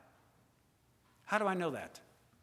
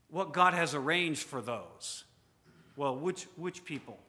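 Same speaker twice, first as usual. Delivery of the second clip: ordinary talk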